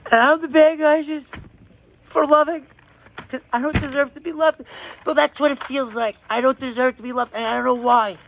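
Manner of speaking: high, cracking voice close to crying; pleading through tears; tearful. Real, not acted. Asking the camera why he isn't loved, close to tears. Breaking down about not deserving love.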